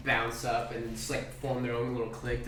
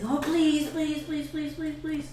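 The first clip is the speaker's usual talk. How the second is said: put-on very high, repeated little-kid pleading; begging goblin kids; slower than usual. Goblin kids begging the kobold to climb, high pleading. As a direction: beg in a squeaky put-on voice. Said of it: "And they say".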